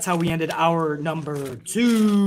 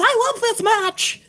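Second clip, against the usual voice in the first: high, slow, sing-song fan voice; mock cheerful fan; slower than usual. Host mocks a virtual fan cheering at home, in a high sing-song fan voice. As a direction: squeal it like a giddy cheerleader fan. Imitating fan Susan with pom-poms.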